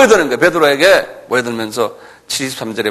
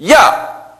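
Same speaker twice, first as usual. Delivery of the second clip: high shouted "hey"; sharp challenge; 12 semitones higher, 4 dB louder than usual. Acted. Soldier catches Peter slipping out, sharp challenging shout. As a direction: stop him with one high yell.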